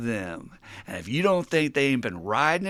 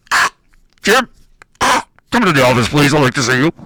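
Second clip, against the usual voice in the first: very loud mock PA page; loudspeaker announcement; 16 dB louder than usual. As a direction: boom it out like a car-lot loudspeaker. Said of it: "they have these loudspeakers on automobile lots".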